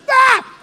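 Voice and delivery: sudden loud, high "ah"; loud imitated exclamation; mimed effort cry. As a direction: let out a short high yelp. Mimics mourners throwing dirt on grave.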